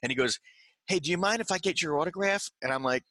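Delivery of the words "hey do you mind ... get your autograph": put-on polite young fan.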